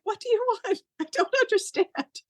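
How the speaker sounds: high, mock-exasperated plea; high-pitched, half-laughing exasperation. Embarrassed retelling of pleading with butterfly.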